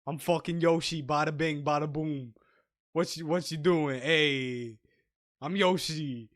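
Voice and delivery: loud Brooklyn street voice; Brooklyn tough-guy Yoshi. Pretend. Pitching a tough Yoshi, loud Brooklyn accent. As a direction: play Yoshi as a Brooklyn thug. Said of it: "That's the voice".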